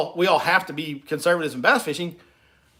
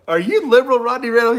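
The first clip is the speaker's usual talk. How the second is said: 1 mock accusing; 6 semitones higher, 6 dB louder than usual. Real, not acted. Teasing a chatter.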